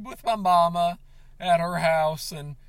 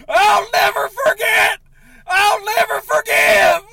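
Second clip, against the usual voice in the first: much louder and higher, shouting through tears; sobbing rage; 13 semitones higher, 13 dB louder than usual. Acted. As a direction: yell it out, crying with fury. Furious vow after breakup.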